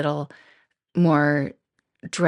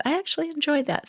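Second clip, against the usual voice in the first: much higher, quick "I actually enjoyed that"; pleased discovery; 10 semitones higher, faster than usual. Pretend. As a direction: sound happily surprised at yourself. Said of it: "Start with that".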